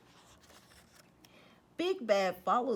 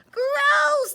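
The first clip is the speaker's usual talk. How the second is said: high, loud gross; disgusted cry; 13 dB louder than usual. Acted. Pigs sneezed on, crying out in disgust. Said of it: "they all cried".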